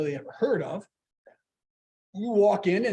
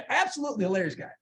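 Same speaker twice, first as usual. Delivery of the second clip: ordinary talk, nothing marked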